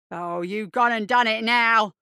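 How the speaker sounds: loud, slow, drawn-out threat; bully making a show of it; theatrical menace. Acted.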